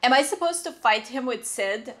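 normal talking voice

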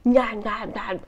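teasing blabber noise; mocking nya-nya. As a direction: mimic gossiping chatter. Mocking people who blab everything.

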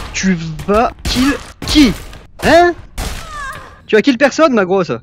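slow mocking drawl rising to a high loud jeer; mocking taunt. Taunting the fallen enemy fighter, slow then loud mocking. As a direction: rub her defeat in her face.

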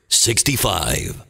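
ordinary talk

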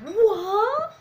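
loud, high rising "what"; acted flabbergasted outburst; shocked yelp. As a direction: shriek it in disbelief, swooping upward. Chopper and Okiku shocked reaction.

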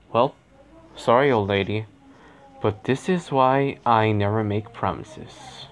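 ordinary talk, nothing marked

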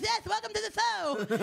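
"welcome to the show" lisped, each s turned to a wet th; high, spitty mock stage greeting with "show" said as "thow"; retainer lisp. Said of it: "it makes me spit a lot".

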